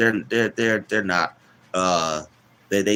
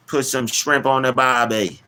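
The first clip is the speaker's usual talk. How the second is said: higher, badly faked Australian accent; terrible Australian accent; 5 semitones higher than usual. Streamer clowning about Australia, terrible Australian accent. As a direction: ham up a bad Australian accent. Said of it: "I know that was terrible".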